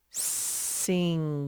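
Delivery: "sings" very slow, with the ending held long; sounded-out final S. Shown as a demonstration. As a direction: stretch "sings" out as sing-zzz. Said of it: "it will have an S at the end".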